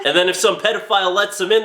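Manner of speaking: usual speaking voice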